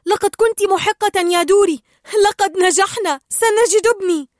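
high, loud, triumphant cries; elated shout. A narrator's reading. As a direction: cry out in joyful hope. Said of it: "صاح مارلين".

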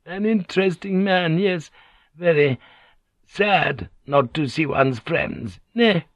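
high, lightly teasing woman's voice; drawn-out, artful small talk; polite and carefree. A narrator's reading. Kyoko needling the lady, polite and carefree. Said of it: "their voices polite and carefree".

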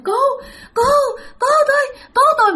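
high, broken cries for help; screaming for help. Retold or imitated. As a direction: cry out in short, desperate bursts. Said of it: "các bạn la làng lên". (la làng cầu cứu, giọng cao thất thanh)

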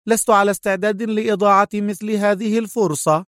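high, scornful woman's voice; high-pitched mocking refusal; sarcastic cry. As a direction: scoff at him in a high voice. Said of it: "صاحت في سخرية".

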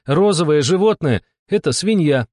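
high, emphatic spelling-out; exasperated insistence. A narrator's reading. Steklova explaining the clue to Yulia, exasperated. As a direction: drive the obvious answer home.

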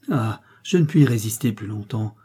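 ordinary delivery

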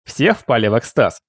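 plain reading voice

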